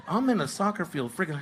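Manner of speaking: ordinary talk, nothing marked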